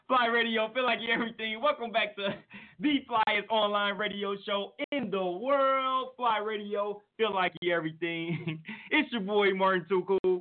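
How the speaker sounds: radio host intro. Acted. Radio show intro jingle.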